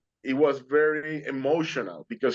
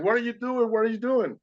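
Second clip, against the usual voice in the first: high-pitched yell without real volume; retold scream; 9 semitones higher than usual. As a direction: imitate a man screaming from his boat, high and urgent. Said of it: "he started screaming at me".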